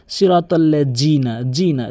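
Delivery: "জিনা" with a hard j sound; common mispronunciation. Shown as a demonstration. Teacher mimicking most people's mistake, hard j. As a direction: say "সিরাতাল্লাযীনা" with j for dh. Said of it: "জিমের উচ্চারণ করে ফেলে".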